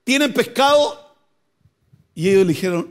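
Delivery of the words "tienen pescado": loud, higher call across the water; shouting from the shore. Retold or imitated. Jesus calling out loudly to the fishermen from shore. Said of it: "le está gritando".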